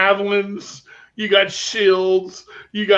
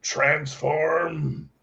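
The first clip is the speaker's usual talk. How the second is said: Optimus Prime; 4 semitones lower, 3 dB quieter, much slower than usual. Pretend. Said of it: "I looked at him and I went".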